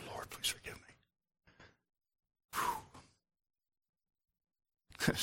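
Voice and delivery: a real whispered plea under the breath; whispered aside. Real, not acted. Pastor asking God's pardon after a slip, whispered. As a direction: whisper it to God, barely audible.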